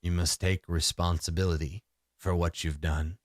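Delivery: low, solemn spirit voice; deep resonant voice. Acted. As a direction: ancient being passing judgement, low. The deer spirit speaks again.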